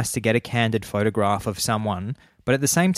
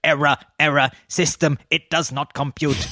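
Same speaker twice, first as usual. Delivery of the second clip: higher robotic error message voice; 5 semitones higher than usual. Retold or imitated. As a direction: play a malfunctioning computer. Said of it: "They might go".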